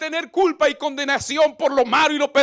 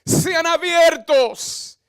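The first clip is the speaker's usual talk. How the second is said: slow solemn command; 4 semitones higher, 5 dB louder, much slower than usual. Acted. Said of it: "y dijo".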